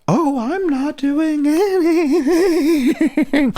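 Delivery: high, slow, innocent protest with a laugh in it; mock innocent troll. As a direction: play the troll acting all innocent. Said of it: "stop it".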